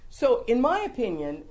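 ordinary delivery

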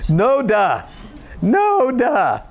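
dry sarcastic jab. Real, not acted. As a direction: scoff at the obvious. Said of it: "sarcastic retort to 'we sinned'".

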